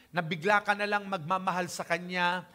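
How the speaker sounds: usual speaking voice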